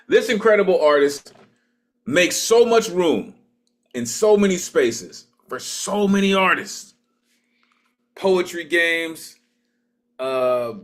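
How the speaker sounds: high, emphatic hype for the next performer; MC introduction. Real, not acted. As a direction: build up the artist like a stage host. Host builds up the next performer.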